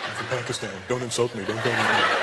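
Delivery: put-on soft Pakistani accent; lightly imitated affronted kid with a Pakistani accent. Pakistani kid objects to being called Indian, soft accented protest. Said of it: "argument with a Pakistani kid".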